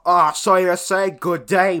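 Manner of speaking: put-on a drunk South African asking about Aussie slang; imitating a drunk bar customer, South African accent; mock South African accent. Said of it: "He was like".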